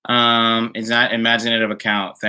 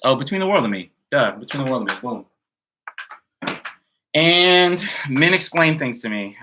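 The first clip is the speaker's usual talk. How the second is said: normal talking voice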